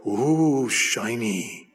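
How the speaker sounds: mock a dazzled, greedy gawker; covetous gawker. Preacher mocking greedy people, dazzled voice. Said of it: "they're like".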